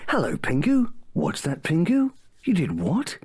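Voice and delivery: put-on lovely, gentle storyteller for kids; bedtime story voice. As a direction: read it like a sweet bedtime tale. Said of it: "bedtime story voice".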